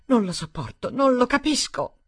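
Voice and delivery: high, loud, crying outburst building up; tearful outburst. Acted. Stephanie crying out at Daniel about proposals, high tearful outburst. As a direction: burst out after weeping silently. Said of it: "Poi sbottò".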